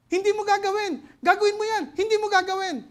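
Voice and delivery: high, loud back-and-forth of conscience; preacher acts out temptation, loud and high; inner voices arguing. Acted. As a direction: voice the flesh and the Spirit pulling. Acting inner tug of conscience.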